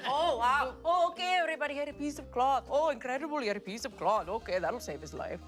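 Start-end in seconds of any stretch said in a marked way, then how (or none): none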